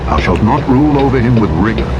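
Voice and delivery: ordinary delivery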